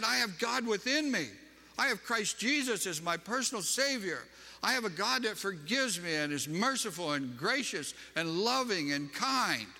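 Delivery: high-pitched, impassioned declaration; fervent testimony. Retold or imitated. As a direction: proclaim your faith, slowing on the final words. Acted testimony in religious argument.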